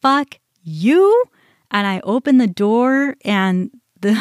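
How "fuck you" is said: reenacted angry outburst, raised and loud; loud retold blow-up; losing it. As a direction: curse at her, letting the last word soar. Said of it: "I lost it at that point".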